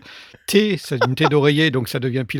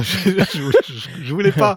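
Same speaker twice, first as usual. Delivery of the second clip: laughing; 3 semitones higher, 5 dB louder, slower than usual. Real, not acted. Laughing apology after giggle fit.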